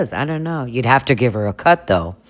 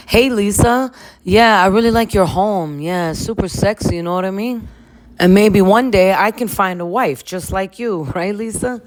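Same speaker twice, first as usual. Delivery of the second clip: higher, eager bro flirting; flirty Jason voice; 6 semitones higher than usual. Acted. Jason flirting with Lisa about her home, eager bro voice. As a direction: schmooze the hostess, pleased with himself. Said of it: "And Jason is like".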